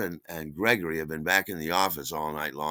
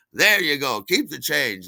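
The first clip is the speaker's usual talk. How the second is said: loud, higher, jovial host voice; hearty good mood; 7 semitones higher, 9 dB louder than usual. Retold or imitated. Friendly party host paying the delivery man, loud and hearty. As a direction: hand it over with big generous cheer. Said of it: "he's really friendly, and he's really in a good mood".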